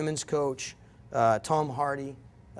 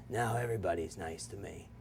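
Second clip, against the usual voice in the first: hushed quote; 8 semitones lower, 9 dB quieter than usual. Retold or imitated. Scooter's tearful words retold.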